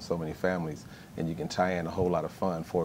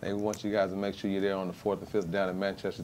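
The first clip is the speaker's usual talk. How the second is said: ordinary talk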